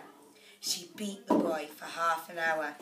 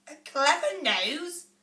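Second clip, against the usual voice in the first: scornful disbelief; 7 semitones higher, 4 dB louder than usual. A narrator's reading. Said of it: "his mother hissed".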